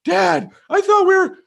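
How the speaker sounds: put-on very high, slow child voice; scared little boy. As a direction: whimper it like a small kid. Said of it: "I'm like".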